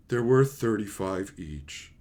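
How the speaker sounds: slow, low imitation of a defeated trader; crestfallen. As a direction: give in glumly, dragging it out. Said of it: "he just looks crestfallen".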